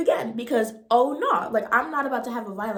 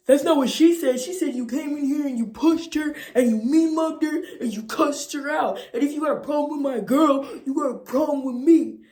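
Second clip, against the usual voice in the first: louder, higher, threatening; confrontational boyfriend; 4 semitones higher, 7 dB louder than usual. Retold or imitated. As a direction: square up and accuse. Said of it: "So he goes".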